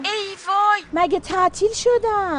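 alarmed realisation. Acted. Mother realising the children's school is out, alarmed. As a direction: cry out in sudden alarm.

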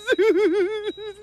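a high giggle mixed with crying; laughing sob. Acted. Companion apologizing to Behnam, teary giggle. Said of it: "الكل بفكرني عم أضحك وأنا بكون عم أبكي".

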